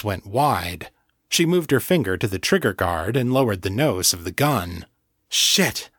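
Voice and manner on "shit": heartfelt curse, pitched up; feelingly. A narrator's reading. Lisa recognising John, said feelingly. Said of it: "she said feelingly".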